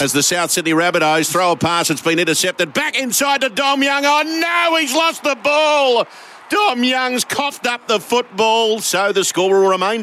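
high, excited footy commentary; mock-dramatic rugby league call; live play-by-play. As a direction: call the match with rising drama, then settle.

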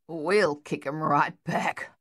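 slow growl. A narrator's reading. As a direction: growl it back, slowly. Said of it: "Luther growled".

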